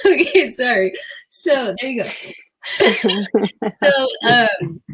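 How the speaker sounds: giggly apology, fighting the laughter; laughing. Real, not acted. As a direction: still laughing while trying to go on. Laughing fit after child's remark.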